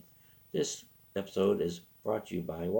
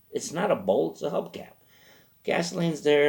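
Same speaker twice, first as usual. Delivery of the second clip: usual speaking voice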